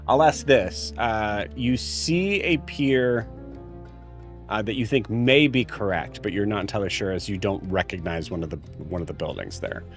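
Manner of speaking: ordinary delivery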